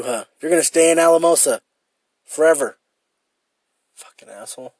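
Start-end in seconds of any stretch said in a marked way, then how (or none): 3.9-4.7 much softer, fond mock insult; teasing mutter. Real, not acted. Teasing his friend for staying in Alamosa, softly muttered. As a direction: mutter it with a grin.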